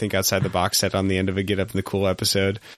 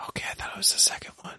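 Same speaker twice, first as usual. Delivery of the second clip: whispering; 8 dB quieter, much faster than usual. Real, not acted. Joking secret confession.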